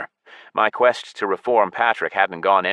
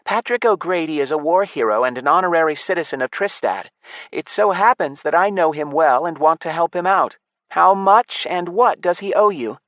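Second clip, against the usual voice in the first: put-on higher, louder young woman's voice; raised elf voice; 10 semitones higher, 4 dB louder than usual. A narrator's reading. Elf girl defending Patrick, raised higher voice. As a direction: sound firm but not threatening. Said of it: "I raised my voice".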